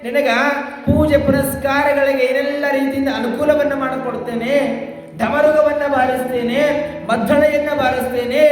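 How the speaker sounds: high, declaimed devotee's plea; devoted Nandisha, pleading. Acted. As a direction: plead to the Lord in high, sustained recitation. (ಏರುದನಿಯಲ್ಲಿ ಭಕ್ತನ ಅಳಲು)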